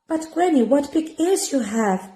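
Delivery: put-on high, wondering girl; wondering little girl. Girl remarking on granny's ears. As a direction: innocent amazement.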